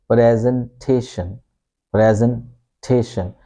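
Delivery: "presentation" with E as in pen, PREZ-en-TAY-shun; British "presentation", broken before TAY; British pronunciation. Shown as a demonstration. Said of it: "pronounce this E as it is in pen".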